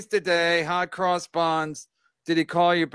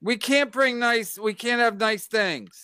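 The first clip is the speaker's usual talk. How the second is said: mock exasperation; 6 semitones higher than usual.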